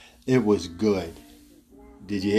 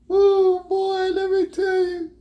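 laughing. Real, not acted. Laughing after retelling his sickness.

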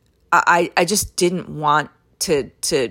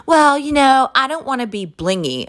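snooty Karen; 8 semitones higher than usual. Retold or imitated. Imitating the customer turning down a design, prissy. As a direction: put on the customer's uppity tone. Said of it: "the woman was just like".

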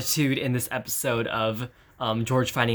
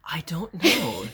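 whispering; 4 semitones higher, slower than usual. Real, not acted. Whispered uncertain reply.

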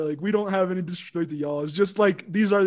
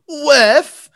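loud, high mock dog huff with a laugh; loud laughing dog sniff; 6 semitones higher, 12 dB louder than usual. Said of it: "his dog just goes".

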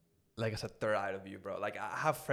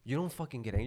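ordinary delivery

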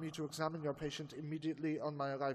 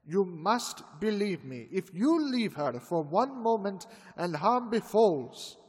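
building to a loud, forceful plea; insistent warning that keeps rising; urgent warning; 10 dB louder than usual. Acted. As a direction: press the danger harder with each line. Professor urgently warns the fiancé.

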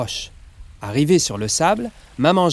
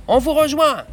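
high, loud call across the water; 13 semitones higher, 4 dB louder than usual. Acted. Papa Jim calls out to the boat that they'll follow. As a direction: shout so they can hear you. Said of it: "crie Papa Jim".

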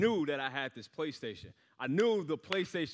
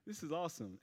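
forced enthusiasm; 5 semitones higher, 5 dB quieter than usual. Retold or imitated. Faking gratitude for wrong gift.

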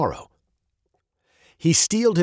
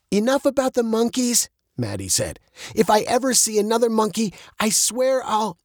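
put-on higher, fast, fed-up girl's voice; exasperated Maddie; 10 semitones higher than usual. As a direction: cut off mid-threat.

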